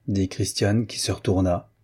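ordinary delivery, nothing marked

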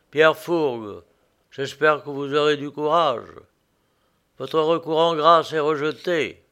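plain reading voice

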